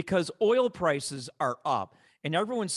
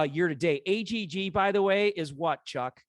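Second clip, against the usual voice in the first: ordinary delivery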